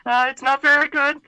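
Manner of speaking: very high squeaky puppet voice; David ventriloquist puppet. Pretend. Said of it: "I'm gonna bring out the, uh, the David ventriloquist puppet".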